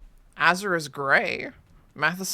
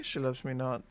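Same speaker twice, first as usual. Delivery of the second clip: gloomy low mutter; 5 semitones lower, 8 dB quieter than usual. Pretend. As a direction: lower and softer, glum. Petal game alternating voices.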